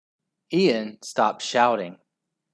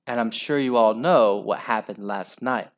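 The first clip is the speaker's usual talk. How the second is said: ordinary delivery, nothing marked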